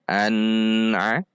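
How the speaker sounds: an with long held N, then a; lengthened N. Shown as a demonstration. Teacher shows wrong reading, an dragged long before a. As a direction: stretch the N of an before a. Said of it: "Tidak boleh dibaca panjang".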